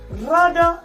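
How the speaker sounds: ordinary delivery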